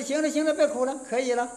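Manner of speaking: loud insistent there there; firm calm-down. Retold or imitated. Soothing a sobbing woman.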